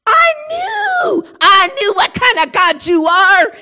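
high, loud, anguished shout; crying shout. Acted. Jonah shouting at God, tearful outrage. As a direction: wail it at God in frustration.

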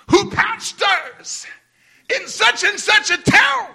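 booming heavenly call rising into a shout; voice of God. Retold or imitated. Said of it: "God didn't go".

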